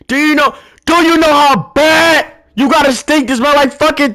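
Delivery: outraged rant. Real, not acted. Ranting about coworker's taco smell.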